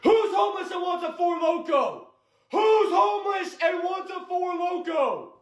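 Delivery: calling out. Acted. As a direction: shout it to the whole street. Prank vlogger calling out.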